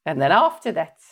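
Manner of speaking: lightly done Brummie monotone, no inflection; a hint of a flat Birmingham accent. Shown as a demonstration. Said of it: "Birmingham accent's a bit like that".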